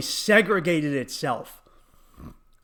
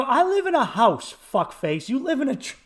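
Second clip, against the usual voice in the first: high-pitched mock indignation; retold indignant outburst; indignant comeback; 6 semitones higher than usual. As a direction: throw it back at them, half laughing.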